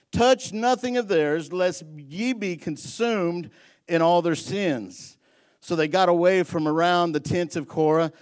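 loud, high preaching read; scripture reading. Acted. Pastor reads the Korah story to the church, loud proclamation. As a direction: proclaim each verse loudly.

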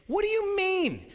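high, whiny mock question; God mocking the priests, high whiny voice; mocking high whine. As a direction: parrot them in a mocking whine. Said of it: "God's mocking them and saying".

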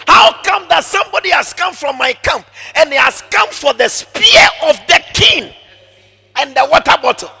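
loud, high scolding shout; angry rebuke. Acted. David shouting at Abner across the valley, angry. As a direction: scold the careless guard.

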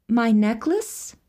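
lightly voiced maiden. A narrator's reading. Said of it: "replied the maiden".

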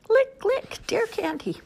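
high licking deer; squeaky little deer. Acted.

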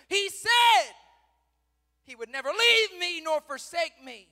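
high, loud cry of grievance; protesting shout. Acted. Believer holding God to his promise, protesting shout. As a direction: throw the promise back loudly.

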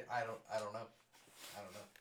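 ordinary talk

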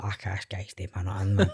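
low, quiet aside to himself; muttered private thought. Retold or imitated. Retelling his first impression of the new castmate, quietly muttered. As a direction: inner voice, muttered under the breath. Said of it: "I thought".

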